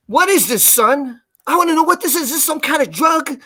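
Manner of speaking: a hint of a gruff older cop grilling a kid; suspicious old cop. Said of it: "He's like this".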